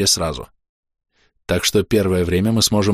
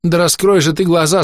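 breaking down angrily; 9 semitones higher than usual. Acted. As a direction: snap and plead at once. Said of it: "Биара сорвалась".

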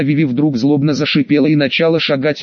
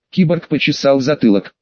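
plain reading voice

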